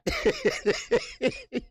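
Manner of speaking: rising high-pitched laugh. Real, not acted. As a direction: let a high laugh rise. Laughing at Rolly.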